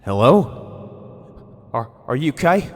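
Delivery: loud call into the woods. Acted. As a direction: shout through the trees, unsure. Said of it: "I called".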